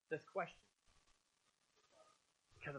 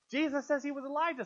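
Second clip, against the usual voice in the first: higher and louder, pressing the point; emphatic repeat; 6 semitones higher, 5 dB louder than usual. Real, not acted. Preacher pressing the contradiction on listeners, emphatic and raised. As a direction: hit it hard to expose the contradiction.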